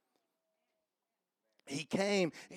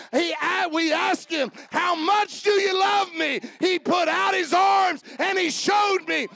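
higher, louder shouted preaching building to a peak; preaching at full force; 8 semitones higher, 7 dB louder than usual. Acted. Preacher to congregation, climbing shouted climax. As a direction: drive it home to the crowd, climbing.

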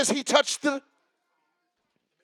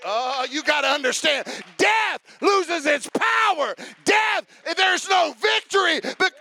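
slow, higher, shouted preacher cadence; full preaching; 4 semitones higher, 4 dB louder, slower than usual. Acted. Preacher proclaiming victory over death, loud and slow. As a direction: drive it home, shouting to the church.

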